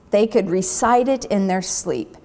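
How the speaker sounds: ordinary delivery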